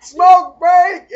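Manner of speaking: loud, high call for smoke break. Retold or imitated. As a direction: shout it across the ward. Calling out ward smoke break.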